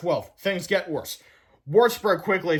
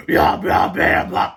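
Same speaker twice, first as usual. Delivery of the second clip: loud, rough monster cry; evil creature chant; evil toilet; 12 semitones lower, 8 dB louder, much slower than usual. A narrator's reading. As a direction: bellow it like a hungry monster. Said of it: "cried the evil toilet".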